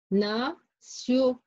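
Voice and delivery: na, pause, sio with an s sound; word split into syllables. Shown as a demonstration. Teacher breaking down national for pupils, separate Na and sio. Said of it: "Regardez là".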